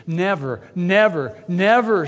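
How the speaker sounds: rising, building never; shouting Peter. Acted. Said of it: "shouts Peter".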